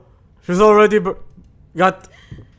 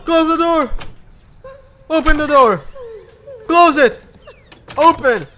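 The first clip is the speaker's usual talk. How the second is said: panicked orders; 9 semitones higher than usual. Real, not acted. Panic door commands.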